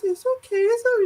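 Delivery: put-on very high, small child voice; high retold whimpering reassurance; crying little boy. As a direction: imitate a tearful kid with a lollipop. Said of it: "crying but sucking on a lollipop".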